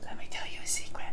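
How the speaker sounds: hushed unvoiced whisper; whispering a secret.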